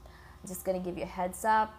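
ordinary delivery

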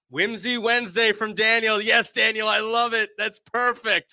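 high, bright delighted read. Real, not acted. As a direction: gush over the comment. Reacting to a comment, delighted.

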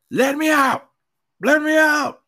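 high, frantic mock scream of a passenger; retold scream. Woman in the back seat screaming at the driver, frantic high scream. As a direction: shriek it like she's trapped. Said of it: "starts screaming".

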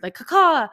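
loud, high bird caw "caw-caw". Retold or imitated. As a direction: croak it like a crow. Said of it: "why did that sound like a bird?".

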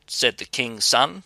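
plain reading voice

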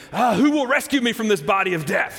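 loud, high-pitched cry of exasperation; exasperated outburst. Acted. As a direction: cry out for rescue, at the end of your rope. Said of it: "he's exasperated".

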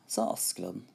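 ordinary delivery, nothing marked

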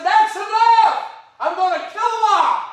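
shouted, high and loud, I'll kill 'em all; a furious God bellowing at Moses; angry God. Acted. Preacher playing God raging at Moses, full-throated shout. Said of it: "He was mad".

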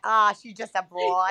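drawn-out mock New York accent. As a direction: play an old-time New Yorker. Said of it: "Like in New York, being like".

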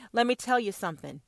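normal talking voice

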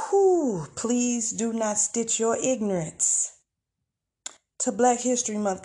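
usual speaking voice

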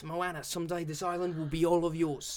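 lightly voiced Polynesian grandmother; a gentle hint of island grandma; [name] impression. Doing her favourite movie voice, lightly voiced Moana grandmother. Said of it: "[name]'s voice".

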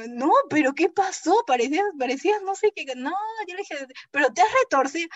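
high, loud worried pressing; alarmed friend. Retold or imitated. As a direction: push for an answer, worried.

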